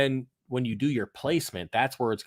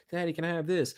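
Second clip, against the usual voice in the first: put-on high little kid asking; 8 semitones higher than usual. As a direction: ask like a pleading child. Dad voices his kid.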